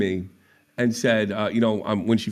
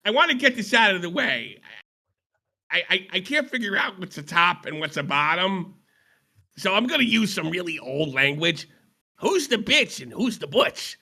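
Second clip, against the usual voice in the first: Phantom impression; 5 semitones higher than usual. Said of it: "[name] does Phantom impression".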